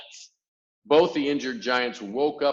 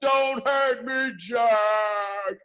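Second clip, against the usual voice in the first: very high, loud, slow shouted plea; pleading yell; 12 semitones higher, 7 dB louder, much slower than usual. A narrator's reading. Giant yelling to Jack for mercy. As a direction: shriek for mercy. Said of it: "he yelled".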